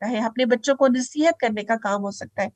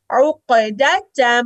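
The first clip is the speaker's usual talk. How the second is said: drawn-out chanted उकदा; slow recitation; 4 dB louder, much slower than usual. Shown as a demonstration. Teacher reciting the word for students.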